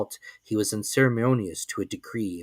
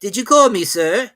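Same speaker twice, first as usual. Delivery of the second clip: put-on higher, polite young servant; servant's voice; 10 semitones higher, 9 dB louder than usual. A narrator's reading. Servant answering his master's call, higher polite voice.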